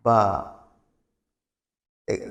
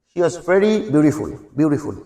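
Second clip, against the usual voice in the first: American "বিউটিফুল" with the t flapped like r, slowed; teacher demos the American "beautiful" with a flapped t; American accent. Shown as a demonstration. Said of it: "কিন্তু অনেকটা র এর মতো বলতে পারে".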